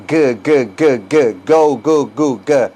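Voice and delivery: "G, g" as repeated hard g bursts; hard g demo. Shown as a demonstration. Teacher isolates the "g" of again, repeated hard bursts. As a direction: punch out "Go, go" with a hard g. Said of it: "La pronunciación de again".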